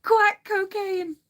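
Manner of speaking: high, slow, laughed-out words; laughing hard. Real, not acted. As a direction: repeat the punchline, still cracking up. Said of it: "make me laugh so hard".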